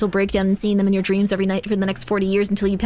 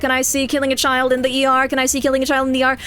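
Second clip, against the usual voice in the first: auctioneer voice; 4 semitones higher than usual. Pretend. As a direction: rattle it off like an auction. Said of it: "Did you just go full auctioneer voice?".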